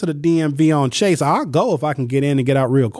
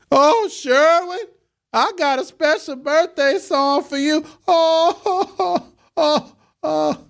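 high, held falsetto croon; put-on high-pitched birthday crooner; mock birthday serenade; slower than usual. Mimics colleague's birthday serenade.

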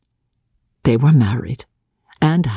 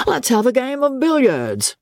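eager friend; 11 semitones higher than usual. A narrator's reading. Friend proposes billiards.

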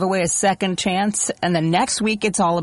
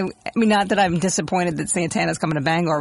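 ordinary talk, nothing marked

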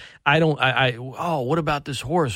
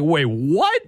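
shocked disbelief; 6 semitones higher than usual. Retold or imitated. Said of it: "you can hear it in his voice".

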